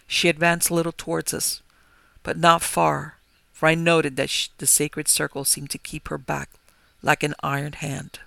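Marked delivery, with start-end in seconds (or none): none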